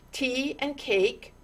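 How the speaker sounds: plain reading voice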